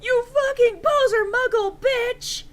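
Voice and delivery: loud, high sobbing yell, slowing at the end; hammed-up tearful shriek; crying shout. A narrator's reading. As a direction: wail the insult through tears. Betrayed boyfriend yelling while crying.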